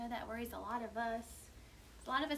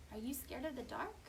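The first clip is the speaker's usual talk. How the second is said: hushed question; 8 dB quieter than usual. Acted. Hushed question about the dark.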